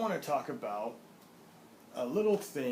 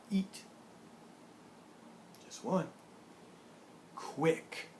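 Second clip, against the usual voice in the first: usual speaking voice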